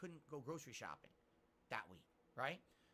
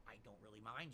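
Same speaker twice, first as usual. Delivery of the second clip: ordinary delivery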